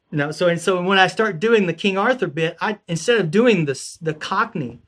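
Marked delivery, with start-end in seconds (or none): none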